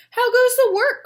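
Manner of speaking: loud, high farmer's call. Acted. Farmer calling out to duck.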